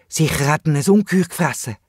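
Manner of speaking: ordinary delivery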